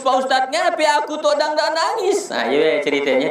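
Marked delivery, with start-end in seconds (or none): 0.0-2.3 high-pitched whimpering question, played for laughs; mock tearful complaint. Pilgrim confiding in his ustad, mock tearful whine. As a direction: imitate a man lamenting his dry eyes. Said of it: "dia nangis dirinya seorang".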